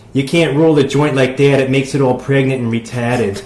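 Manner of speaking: loud imitated Boston local; Boston accent. Co-host imitating an overheard Boston smoker, loud Boston accent. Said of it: "heard a Boston person say".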